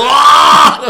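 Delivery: high, loud held yell; comic shriek of disbelief; mock outraged scream. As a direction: let out a hammed-up scream of indignation. Retold reaction to being called addict.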